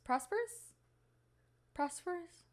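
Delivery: "prosperous" high and soft, rising as a question; unsure of word. Real, not acted. Checking a word mid-wish, "prosperous" softly lifted in doubt. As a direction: test "prosperous" quietly with a rising end.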